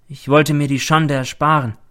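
usual speaking voice

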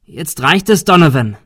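loud, exasperated outburst; fed-up shout. A narrator's reading. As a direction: shout to put a stop to it. Confronting the gunman.

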